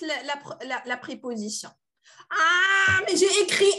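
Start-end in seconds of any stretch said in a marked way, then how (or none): 2.3-3.7 a high, loud dismayed cry, higher and louder; real alarm at her own slip. Real, not acted. Teacher catching her own mistake, dismayed cry.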